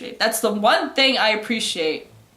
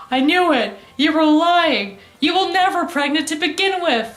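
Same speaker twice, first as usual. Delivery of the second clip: higher, louder hammed-up outcry; mock vindicated accusation; 6 semitones higher, 5 dB louder than usual. Killer exposing her rival's lie, mock outraged. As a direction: declaim it like an anime showdown. Acted triumphant accusation after killing.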